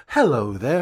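a lightly put-on cheesy presenter hello; mock fake-smile greeting. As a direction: mimic his forced smiley phone voice. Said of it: "put on this little fake smile and go".

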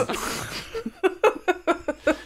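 high burst of laughter. Real, not acted. Laughs after impression.

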